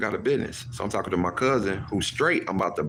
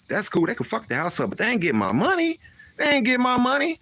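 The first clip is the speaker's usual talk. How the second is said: cocky brag; 13 semitones higher than usual. Retold or imitated. Mock carefree boast, quoting himself.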